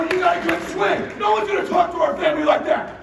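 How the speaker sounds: high, loud pep shout; fired-up shout. Retold or imitated. Dad firing up his son before the back nine, shouting. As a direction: roar it like a fired-up coach.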